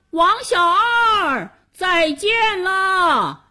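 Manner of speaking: high, long drawn-out calling; distant farewell call. Acted. Vanished old man bids the boy goodbye, drawn-out high call. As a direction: call out slowly as if from far away. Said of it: "只听见一个声音说".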